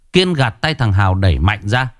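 plain reading voice

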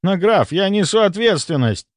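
high-pitched, worried objection; agitated protest. A narrator's reading. A doctor protests to the count that he is responsible, agitated.

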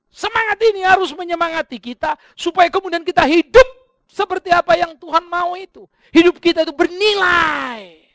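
loud, high, ringing sermon voice; preaching at full force. Acted. Pastor urging the congregation, full-force preaching.

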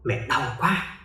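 soft ghostly moan of pain; trembling whisper.

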